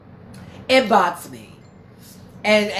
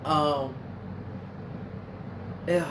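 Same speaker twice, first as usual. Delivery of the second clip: choked up; 7 semitones lower, 8 dB quieter than usual. Real, not acted. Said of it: "oh, God, I can't".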